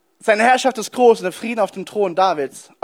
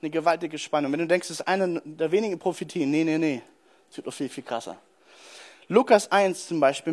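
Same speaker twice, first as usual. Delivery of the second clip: ordinary delivery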